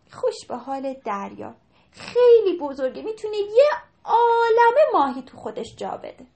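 put-on a child's longing voice; little girl's voice. A narrator's reading. Girl wishing aloud to sea. (صدای دخترک کوچولو)

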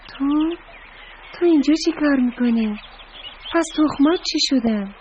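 high-pitched, startled questioning; very surprised. A narrator's reading. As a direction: stammer the first word, then ask in astonishment. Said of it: "خیلی تعجب کرد، پرسید". (با تعجب و صدای زیر)

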